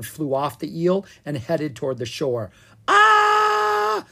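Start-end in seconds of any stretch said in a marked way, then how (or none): 2.9-4.1 long, very high scream; loud flying-through-the-air shriek. A narrator's reading. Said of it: "they screamed".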